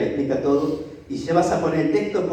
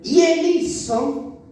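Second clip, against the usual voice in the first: slow dramatic; 10 semitones higher, much slower than usual. Acted. Slow acted narration.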